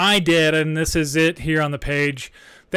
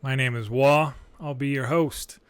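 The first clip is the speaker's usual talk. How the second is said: usual speaking voice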